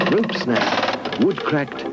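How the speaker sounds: plain reading voice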